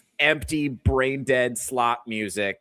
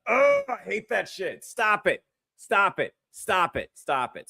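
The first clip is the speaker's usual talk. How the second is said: pained disgust; 5 semitones higher than usual. Real, not acted. Begs for the cringe clip to stop.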